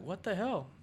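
high startled cry of a horrified father; retelling a dad's shock at the mess. Retold or imitated. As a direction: gasp it out, pitch jumping way up. Dad finds ice cream mess.

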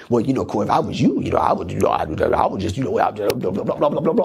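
low, mocking know-it-all voice; mock advice-giver. Preacher mocking people who push their opinions on him, low mocking voice. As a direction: imitate nosy people offering opinions, low and dismissive.

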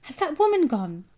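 high, loud anxious question; shouting. A narrator's reading. Boy checking the woman has left, shouting. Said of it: "I shouted".

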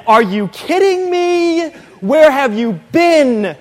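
high, loud disbelief; incredulous mock. Mocking a dumb question.

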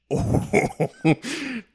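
high, drawn-out gleeful cackle; real burst of high laughter. Real, not acted. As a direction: laugh with relish, high and long.

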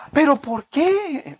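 high, slow, puzzled question; puzzled mom. Retold or imitated. Son quotes his mother asking why in Spanish. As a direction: ask why like a hurt mother.

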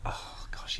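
breathy whispered sigh. Acted. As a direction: hush it, barely voiced. Unvoiced whisper after sip.

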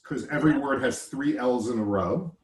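ordinary talk, nothing marked

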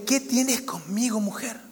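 slow, hushed, fading to almost nothing on mujer; soft reluctant reply to his mother; gently voiced Jesus. Jesus answering his mother at the wedding, slow and hushed.

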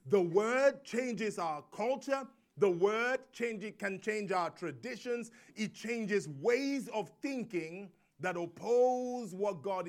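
slow, weighty preaching with stretched key words; emphatic preaching. Acted. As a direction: hammer each claim home to the congregation. Preaches with force.

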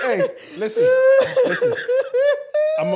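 high laughing call. Real, not acted. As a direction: talk while still cracking up. Trying to talk through laughter.

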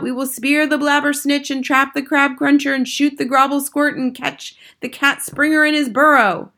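excited listing of the hunt; crying out. Acted. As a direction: vow it as a keen crowd of witches. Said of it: "they cried out".

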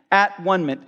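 "at-one-ment" said as three separate words, at, one, ment; split into syllables. Shown as a demonstration. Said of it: "If you divide it up".

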